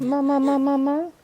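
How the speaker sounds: put-on high sing-song "mama" for baby; coaxing baby. Shown as a demonstration. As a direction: model the word for the baby to copy. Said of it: "I'll try to get him to say mama".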